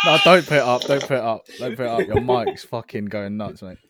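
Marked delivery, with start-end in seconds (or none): 0.1-2.1 loud no, then calmer repeats; fading protest. Real, not acted. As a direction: stop him, then ease off. Friend raising mic, objection.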